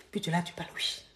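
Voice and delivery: hushed secret phone voice; mock whispering. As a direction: imitate a furtive phone call under the breath. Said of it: "mais tu chuchotes pas, on entend un peu".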